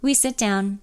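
usual speaking voice

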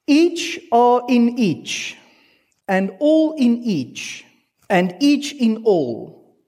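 Augustine's words read slowly to the congregation; solemn quotation. Acted. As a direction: read the church father reverently.

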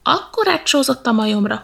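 ordinary delivery

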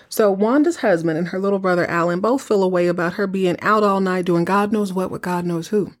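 usual speaking voice

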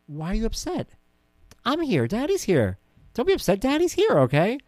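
father calming his crying daughter, high and soothing; comforting dad. Retold or imitated. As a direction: reassure the little girl.

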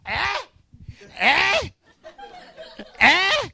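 imitating a baby's high excited ahs, ending in a laugh; baby's excited cries. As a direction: squeal like a one-year-old at his toy. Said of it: "he's like".

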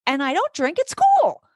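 high-pitched, retold insistence; indignant protest. Teacher defending herself to mom, indignant. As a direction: defend yourself with pitch climbing.

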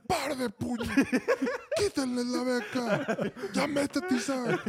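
rabieta imitada, muy aguda y estirada; coach furioso gritando. Retold or imitated. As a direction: lanza órdenes a gritos, exagerando al coach. (berrinche de coach en plena práctica, imitado)

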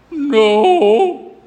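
high, loud mock wail; tearful child. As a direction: imitate a little girl crying out her answer. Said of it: "And tears".

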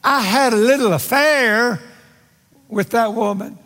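man excusing himself. Acted. Man looks up to God about an affair, excusing himself. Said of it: "Man looks up and says".

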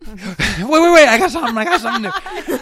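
loud, high, laughing burst; excited interruption. Real, not acted. Guest jokingly cuts in on the hosts, loud and excited. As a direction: butt in loudly, pitch shooting up.

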